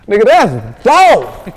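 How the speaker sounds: amazed shout. Retold or imitated. Cousin hearing the beat, shouting loud and slow in amazement. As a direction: drag it out in disbelief.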